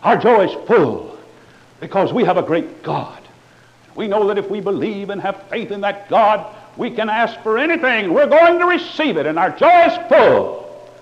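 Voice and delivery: loud, high hammed-up boasting of joy; mock joyful preacher. Parodying preachers bragging of full joy, hammed-up. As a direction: ham up the televangelist's triumph. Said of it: "And then they'll say".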